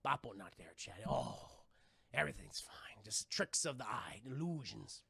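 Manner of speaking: hushed, breathless whisper; narrating a creepy scene in a hush; tense whisper. Acted. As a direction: whisper it like a scary movie.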